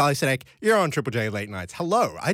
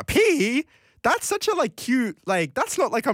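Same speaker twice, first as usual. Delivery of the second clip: high gushing coo; gushing over cute; 11 semitones higher than usual. Real, not acted. Host gushes to a listener about a sweet memory, cooing high. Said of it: "That's so cute".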